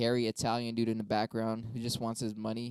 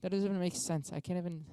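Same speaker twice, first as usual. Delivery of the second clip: ordinary delivery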